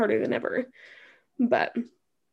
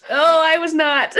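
laughing; 5 semitones higher, 8 dB louder than usual. Real, not acted. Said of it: "holding back the tears".

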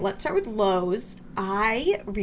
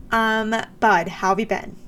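ordinary talk

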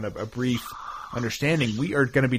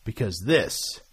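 announcer voice; 4 semitones higher, 8 dB louder, slower than usual. Acted. Announces show like ring announcer.

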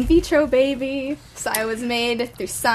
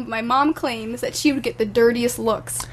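usual speaking voice

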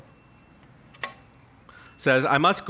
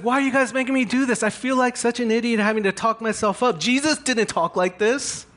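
exasperated Paul; 8 semitones higher than usual. Retold or imitated. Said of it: "It's like".

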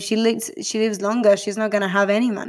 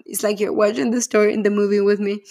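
ordinary talk

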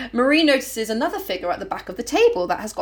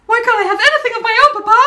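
high, fast, louder whine at papa; whiny child; 11 semitones higher, 7 dB louder, much faster than usual. Retold or imitated. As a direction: sulk loudly like a cheated kid. Marie whining to father.

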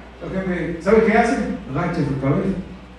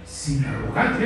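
rising, insistent sermon challenge; preaching at full force; 7 semitones higher than usual. Acted. Preacher urging the congregation to insist, fervent preaching. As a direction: push the congregation not to give up.

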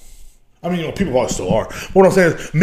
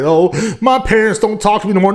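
mock whine; 7 semitones higher, 4 dB louder than usual. Mocking a whining porn star.

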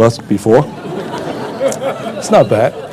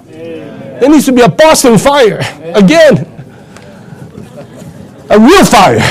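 fiery preaching; 9 semitones higher than usual. Acted. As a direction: preach it with the fire building. Preacher rouses the church.

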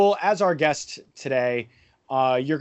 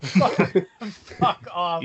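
exasperated disbelief; 5 semitones higher, much slower than usual. Real, not acted. Host keeps rejecting guest's verdict.

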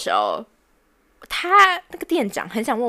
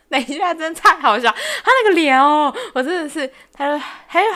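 laughing through the words, higher and louder; can't get the words out for laughing; breaking into laughter, nearly crying; laughing helplessly; 7 semitones higher, 5 dB louder than usual. Real, not acted.

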